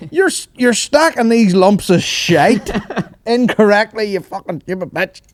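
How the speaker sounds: high, hammed-up giving out; exaggerated berating with a laugh breaking in; mock Irish scolding. Podcaster parodies Irish dad berating turf stacker, mock-angry high voice. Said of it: "so Irish to be like".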